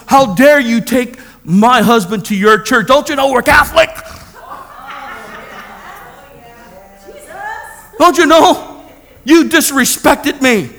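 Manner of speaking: indignant scolding, rising in pitch; imitated angry tirade. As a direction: mimic a furious wife telling him off. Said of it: "She was cursing me out".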